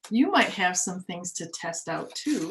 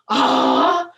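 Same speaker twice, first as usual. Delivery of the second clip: loud rising screams; fox screams; 7 semitones higher, 8 dB louder, much slower than usual. Acted. Fox screaming before the sneeze. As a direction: scream louder and higher each time.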